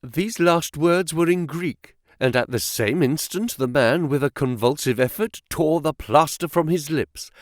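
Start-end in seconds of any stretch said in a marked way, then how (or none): none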